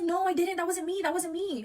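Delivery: high-pitched mock protesting; imitated frantic denial. Boyfriend's denial when confronted.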